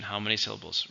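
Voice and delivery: ordinary talk, nothing marked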